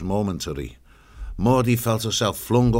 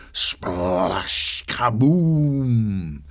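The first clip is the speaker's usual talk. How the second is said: punchy, louder sound words; read out loud; 3 dB louder, much slower than usual. A narrator's reading. Narrator booming the splash into the water, loud. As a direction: boom it out like the crash.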